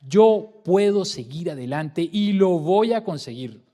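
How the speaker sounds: loud, slow, determined declaration; emphatic morning pep talk to oneself; energetic self-affirmation. Shown as a demonstration. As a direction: convince yourself with every word. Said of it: "esa energía la tenemos que aplicar".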